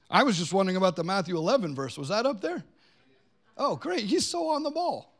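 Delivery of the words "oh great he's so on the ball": high-pitched, pleased praise; delighted aside. Real, not acted. Preacher praising whoever runs the screens, delighted high voice. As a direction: lift it high into an appreciative little aside.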